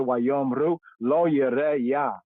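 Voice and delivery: Wayomru lo yireh Yah read slowly in Hebrew. Shown as a demonstration. Teacher reading the Hebrew verse to class. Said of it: "reading Hebrew verse aloud".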